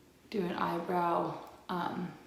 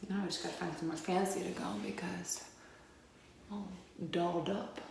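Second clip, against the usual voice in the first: quick playful whisper; 5 dB quieter, faster than usual. Real, not acted. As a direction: whisper it as a joke. Joking aside, hushed and quick.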